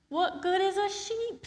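high exasperated question; exasperated Peter. Acted. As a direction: squeak in disbelief. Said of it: "said Peter".

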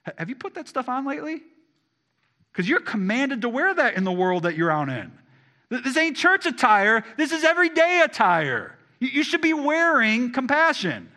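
full-force preaching. Acted. Preacher urging the congregation to wear compassion, at full force. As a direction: drive it home to the pews.

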